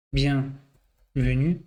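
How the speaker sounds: BIENVENUE very slow, split as bien... venue; drawn out while typing. Real, not acted. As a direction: say "Bienvenue" slowly in pieces, as if typing it. Says it while typing the word.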